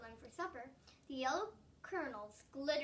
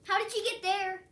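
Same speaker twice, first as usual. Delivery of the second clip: impatient; 5 semitones higher, 8 dB louder than usual. A narrator's reading. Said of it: "trying to hide his impatience".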